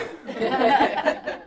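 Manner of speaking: a light breathy laugh after the news; gentle amused laugh. Retold or imitated. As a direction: laugh along, delighted.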